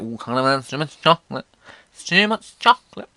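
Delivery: mock scolding adult. Voicing the diet scolding.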